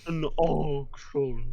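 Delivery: slow sinister drawl. Acted. Menacing drawn out echo.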